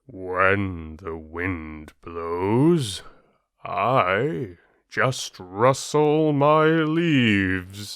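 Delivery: slow, drawn-out boastful oak tree voice; a smug giant tree bragging to the reeds; proud old oak. Acted. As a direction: let the mighty oak savour its pride. Oak tree speaks in story.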